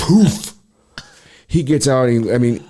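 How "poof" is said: high, puffed burst of noise; imitated powder blast. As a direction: mimic a cloud of powder blowing out. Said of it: "I mean, just".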